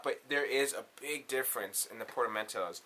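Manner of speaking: ordinary talk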